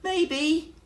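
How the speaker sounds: very high squeaky maybe; small guilty squeak; little mouse voice. Acted. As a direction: answer sheepishly from hiding. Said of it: "came a little voice".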